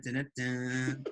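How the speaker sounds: usual speaking voice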